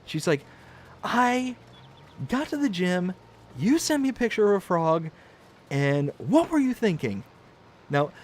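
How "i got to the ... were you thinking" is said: higher, deliberate reproach; mildly hammed-up indignation; upset wife. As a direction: spell out the offense piece by piece. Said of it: "then she proceeded to be upset".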